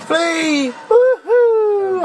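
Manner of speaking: very high squeal building to a loud whoop; high whooping cry. Real, not acted. Player fleeing his pursuer, high whooping cry. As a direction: whoop as you make a run for it.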